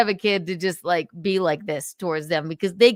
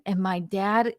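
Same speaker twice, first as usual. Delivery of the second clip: ordinary talk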